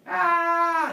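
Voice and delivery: high, loud theatrical scream. Retold or imitated. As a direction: scream like horrified townspeople. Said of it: "they start screaming".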